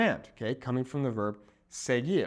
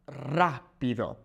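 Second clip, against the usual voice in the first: rápido broken up, stress on RA; stress on first syllable; much slower than usual. Shown as a demonstration. Teacher demonstrates the accent mark, rápido stressed on RA. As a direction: punch RA in rápido, then drop on pido. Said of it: "that little accent mark over the A".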